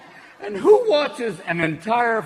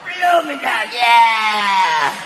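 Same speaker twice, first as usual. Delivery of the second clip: louder gruff sailor voice; loud mock sailor voice; Popeye; 3 dB louder than usual. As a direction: growl it like a cartoon sailor. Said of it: "Popeye be like".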